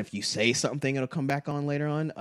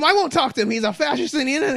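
much higher, louder prissy refusal; mock indignation; 11 semitones higher, 5 dB louder, faster than usual. Mimicking someone refusing to talk, prissy high indignation. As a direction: huff it like a self-righteous scold.

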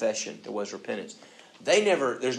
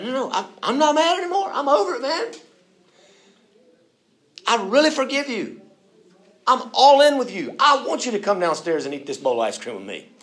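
much higher, louder, half laughing; exasperated pleading dad; 13 semitones higher, 9 dB louder than usual. Acted. A parent begging the sulking child to believe him. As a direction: insist with a helpless laugh.

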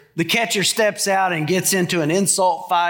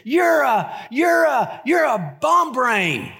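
high shouted stammer; kid's angry sputter; 7 semitones higher, much slower than usual. Retold or imitated. Son shouting at his brother, high stammering shout. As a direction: splutter like a furious little boy.

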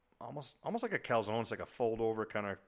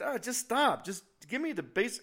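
exasperated plea; 12 semitones higher, 8 dB louder than usual. Retold or imitated. Exasperated quoted reaction.

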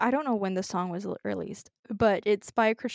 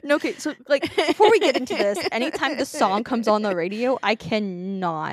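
worked up; 3 semitones higher, 9 dB louder than usual. Real, not acted. Telling her friend the song makes her cry, loud and worked up. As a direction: burst out that she can't take it. Upset about a sad song.

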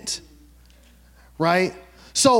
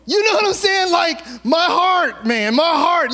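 fired-up shout; 8 semitones higher, slower than usual. Real, not acted. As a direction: let the joy burst out. Preacher fired up by the story.

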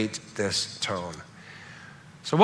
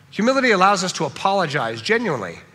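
usual speaking voice

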